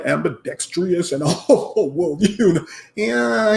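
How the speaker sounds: lightly put-on pretentious talker; hint of a show-off using big words; mock big-word talker. Showing fans how Kwame Brown doesn't talk, lightly mocking big-word talk. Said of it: "he don't talk like that".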